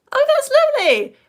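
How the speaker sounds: very high, loud coo; gushing delight. Real, not acted. Reacting to a viewer's video, high gushing delight. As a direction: gush with the pitch shooting up.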